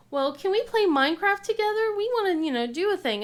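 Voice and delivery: put-on high, sweet pleading kid voice; kids asking nicely. Mom imitating her kids asking to play together, high sweet plea. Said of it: "they went".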